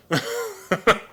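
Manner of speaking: real, high-pitched chuckle; short burst of amused laughter. Real, not acted. Amused at likening the toucan to a dog, chuckling.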